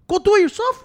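loud, high shout of the order; yelling at a lazy friend to get moving; retold shout. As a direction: bark the order at him.

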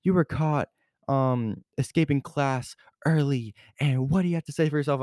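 high, mock-stern teacher scolding, quickening at the end; imitating the teacher scolding a student for leaving class. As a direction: impersonate the strict teacher telling you off. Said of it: "I got yelled at by that teacher".

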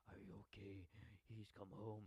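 imitated hushed whisper; breath-only mock concern; Loomis. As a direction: whisper it close over someone. Loomis hovering over Lori, retold.

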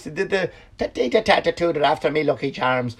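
lightly voiced Irish leprechaun; a hint of a cartoon Irish lilt; mock Irish leprechaun impression. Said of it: "Did they do the voice".

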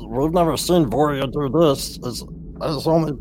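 put-on high childlike goblin voice. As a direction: chatter like a little goblin. Goblin child continues talking.

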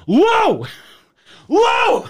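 high-pitched retold yell; acted-out call, voice thrown way up. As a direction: holler desperately to someone not turning round. Acting out calling unheeding neighbour.

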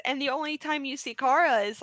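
ordinary talk, nothing marked

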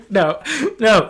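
normal talking voice

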